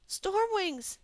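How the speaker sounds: high, sharp cry of alarm; frightened cry out of a nightmare. A narrator's reading. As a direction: wake up shouting a warning. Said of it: "Dane cried".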